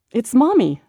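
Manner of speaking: put-on high, loud child's announcement; child announcing. A narrator's reading. Kid announcing mom to the others, high and loud. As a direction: call it out like an excited little kid. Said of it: "someone announced".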